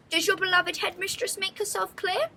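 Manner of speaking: a grand headmistress asking; haughty headmistress. Acted. Headmistress asks her pupils, high and haughty.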